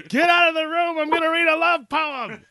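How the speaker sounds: high, loud mock outburst at the husband; playful high-pitched shout; mock shrill yell. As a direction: squeal it out in a hammed-up shriek. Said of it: "You're like".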